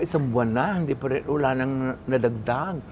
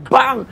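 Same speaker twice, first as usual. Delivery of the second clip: gunshot sound; 10 semitones higher, 10 dB louder than usual. Retold or imitated. Imitated gunshot.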